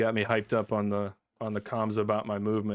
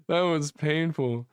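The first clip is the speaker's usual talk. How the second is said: laughing; 4 semitones higher, slower than usual. Real, not acted. Laughing at painful fight.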